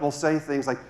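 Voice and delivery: usual speaking voice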